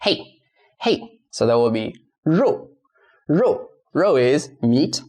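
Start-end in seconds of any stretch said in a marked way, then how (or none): 0.0-1.2 "Hey" as a short falling tone; "Hey" to model Mandarin tone four; fourth tone. Shown as a demonstration. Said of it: "fourth tone". 2.2-3.7 "rou" in a firm Mandarin tone four; fourth tone. Shown as a demonstration. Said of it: "fourth tone".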